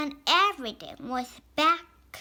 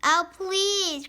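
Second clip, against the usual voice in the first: pleading cry; 6 semitones higher, 6 dB louder than usual. A narrator's reading. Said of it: "cried Mister Gray".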